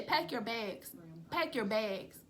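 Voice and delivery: soft, low retold voice of God; quiet prompting. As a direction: repeat it softly, like an inner nudge. Retells God's repeated quiet prompting.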